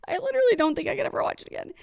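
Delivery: fighting back real tears; choked-up high voice breaking; real tears welling up. Real, not acted. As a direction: voice cracking up high as she tears up. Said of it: "oh my God".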